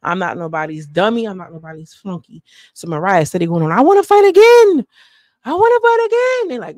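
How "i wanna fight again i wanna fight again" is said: high, loud whiny imitation; mock insistent yell. Mariah to the castmates, a high mock yell. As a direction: whine it like a child who won't quit. Mariah still demanding a fight.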